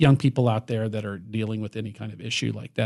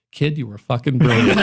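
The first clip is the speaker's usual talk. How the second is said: laughing Albert quote; 4 semitones higher, 5 dB louder than usual. Retold or imitated. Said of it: "Albert looked at Billy and goes".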